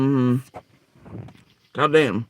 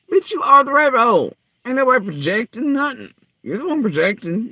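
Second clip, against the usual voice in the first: angry outburst; 6 semitones higher, 3 dB louder than usual. Real, not acted. Ranting back at accuser.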